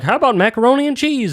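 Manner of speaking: lightly mocked comic carrying on, high pitch; oblivious comic. Mimics comic obliviously carrying on with act.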